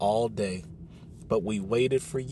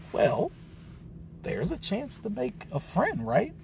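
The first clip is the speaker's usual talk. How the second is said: hopeful; 7 semitones higher, 4 dB quieter than usual. A narrator's reading. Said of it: "She sounded hopeful".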